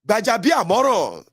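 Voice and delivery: slow, loud, mocking name call; drawn-out name. Real, not acted. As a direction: stretch the name out with scorn.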